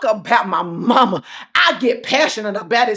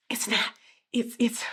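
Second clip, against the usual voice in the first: whisper; 11 dB quieter than usual. Real, not acted. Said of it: "whispered hesitation".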